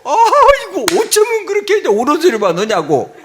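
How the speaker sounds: high, loud mock gush; hammed-up admiration; fawning hostess. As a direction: open on a soaring "aigo", then coo agreement. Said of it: "말도 안 되는 소리를 해도".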